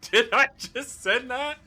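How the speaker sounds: high, loud, laughing through the words; real shocked laughter; giggling in disbelief. Real, not acted. Shocked at own message.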